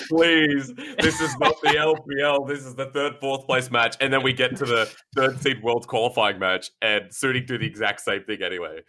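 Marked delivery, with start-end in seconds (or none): 0.1-4.0 high-pitched, hammed-up plea; pleading. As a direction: re-enact your own desperate plea to viewers. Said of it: "it was you pleading".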